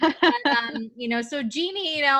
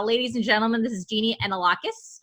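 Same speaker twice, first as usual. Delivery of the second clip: Jeannie Analakis in a flat American accent, ANN-uh-LACK-iss; faster than usual. Shown as a demonstration. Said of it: "So the American accent is".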